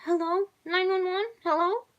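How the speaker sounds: high, panicked call for help; high and urgent, begging someone to answer; frightened girl on phone. A narrator's reading. Girl calls the police in panic, high frightened voice. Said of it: "The girl cried into a phone".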